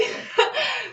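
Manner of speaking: high, soft giggle; self-mocking laugh. Real, not acted. Vlogger giggling at her own bad hearing. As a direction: laugh lightly at yourself.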